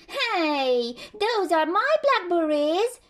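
high, loud mouse squeak; small creature's indignant squeak. Acted. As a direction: squeak it out in protest. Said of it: "he squeaked".

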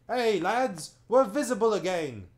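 loud, high excited shout; calling out. Acted. Monopods calling to one another while jumping, loud shout. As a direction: call out to the lads, thrilled. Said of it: "calling out to one another".

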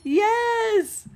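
loud, rising shout of triumph; real burst of elation; triumphant shout. Real, not acted. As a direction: shout it, thrilled you pulled it off. Relieved triumph in game.

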